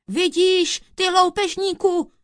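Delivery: high, loud triumphant shout; judge's loud outburst. A narrator's reading. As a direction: yell it at the robber. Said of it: "křikl soudce na kouzelníka".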